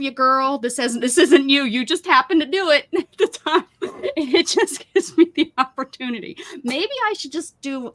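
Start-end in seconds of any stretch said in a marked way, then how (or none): 1.6-6.4 laughing through the words. Real, not acted. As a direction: giggle it out, higher. Laughing while scolding friend.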